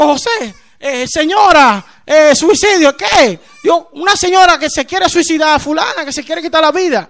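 high, agitated, stumbling report; reenacted panic. New convert telling José of a suicide threat, in acted panic. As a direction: act out blurting bad news in alarm.

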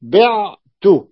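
بعت stretched very slowly and clearly; بعت said slowly, the ع held; slow demo of a word. Shown as a demonstration. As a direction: draw out بعت for the class. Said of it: "أقول".

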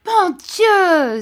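loud, high cry of surprise; surprised cry. A narrator's reading. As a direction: exclaim in shock at seeing an old friend. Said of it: "s'écria Traddles".

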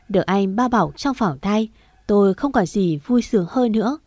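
ordinary delivery, nothing marked

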